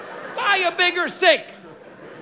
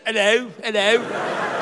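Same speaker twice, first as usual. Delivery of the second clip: slow, lower, joyless British hello; unenthusiastic British greeting; 5 semitones lower, slower than usual. Acted. Comedian shows the British bad attitude in a greeting, unenthusiastic. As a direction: greet with no enthusiasm, mock-British. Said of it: "the British don't have a good attitude".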